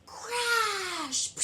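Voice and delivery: high, loud shouted crash. Acted. Teacher shouting the drum crash for kids. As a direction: shout it like falling drums.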